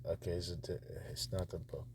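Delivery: ordinary talk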